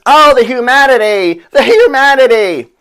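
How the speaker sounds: high, loud wailing cry; screaming. Retold or imitated. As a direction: wail it like a horrified announcer. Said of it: "he's screaming".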